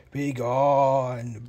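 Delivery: low, eerie ghostly warning; drawn-out spooky command; unearthly voice. Acted. Ghost voice threatens visitors.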